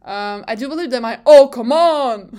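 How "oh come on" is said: loud, slow exasperated outburst; Charles Leclerc. Retold or imitated. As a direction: impersonate a frustrated racing driver. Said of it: "Charles Leclerc is kind of good".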